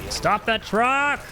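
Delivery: high, drawn-out call; urgent game shout. Real, not acted.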